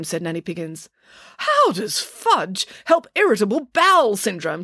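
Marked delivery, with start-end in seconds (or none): 1.4-4.6 high, incredulous demand. A narrator's reading. Doctor questions the fudge remedy, demanding. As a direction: demand an explanation, pitched up. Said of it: "demanded the doctor".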